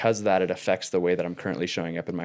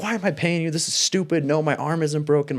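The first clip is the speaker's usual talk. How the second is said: exasperated, pitched way up; fed up; 8 semitones higher than usual. Retold or imitated. Retold to a podcast host, fed up with his therapist's questions, high exasperated rant. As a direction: snap back at the therapist, fed up. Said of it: "At this point, I was just fed up".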